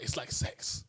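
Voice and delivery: softly whispered impression of a come-on; suggestive mock whisper. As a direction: imitate her in a breathy, suggestive hush. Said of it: "Why are you saying it like that?".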